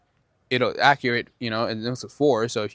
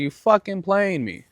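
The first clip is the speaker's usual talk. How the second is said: higher, slower retold call-out; retold confrontation, higher and slower, not shouted; confronting him; 8 semitones higher, slower than usual. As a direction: call him out pitched up and drawn out. Confronting the man out loud.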